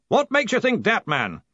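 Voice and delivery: high, blustering challenge; impatient demand. A narrator's reading. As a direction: demand an answer from your underling. Impatient boss challenges nervous underling.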